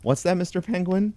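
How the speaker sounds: very high, rising sing-song question; high-pitched pretend curiosity; cooing question. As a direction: ask it like talking to a pet. Pretends to hear penguin speak.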